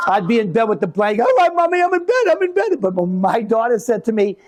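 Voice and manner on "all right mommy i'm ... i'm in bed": put-on high, quick, frightened child's voice; scared little kid. Scared child promises mother he's in bed, high panicked voice. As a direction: squeak it out like a terrified little boy.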